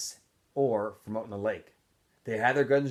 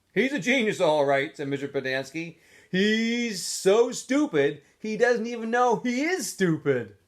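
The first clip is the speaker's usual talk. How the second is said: sarcastic sneer; 10 semitones higher, 8 dB louder than usual. A narrator's reading. Counselor mocks Zero.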